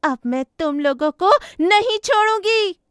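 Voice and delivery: loud, high accusing shout; furious ghost. Acted. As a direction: lash out with a vengeful threat. Possessed daughter turns on father.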